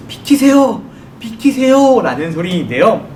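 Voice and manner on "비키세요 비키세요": high, loud siren voiced as words; urgent warning call. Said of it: "이 소리를 우리말로 하자면".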